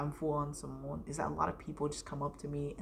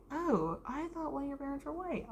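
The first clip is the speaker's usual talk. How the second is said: high-pitched mimicked teacher; imitated teacher; 9 semitones higher than usual. Teacher excusing a wrong guess to a pupil, high imitated voice. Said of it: "She was like".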